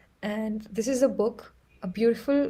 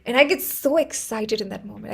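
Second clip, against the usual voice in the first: ordinary delivery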